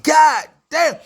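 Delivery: high, loud "God damn" of disbelief; shocked shout. Real, not acted. Reacting to the pill story, loud shocked shout.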